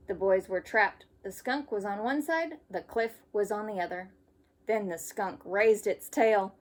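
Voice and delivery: lively storybook read-aloud; reading to children. Acted. Teacher reading a camping story to kids. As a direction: read it brightly for young listeners. Reads picture book to children.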